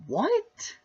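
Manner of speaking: shocked what. Acted. Skit director hears shocking reply.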